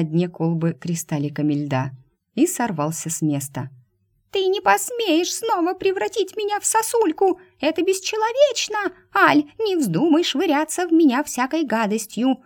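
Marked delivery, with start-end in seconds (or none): 4.3-12.4 high, frantic squeaky protest; panicked mouse squeal. Acted. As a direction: squeal in outrage, pitched way up.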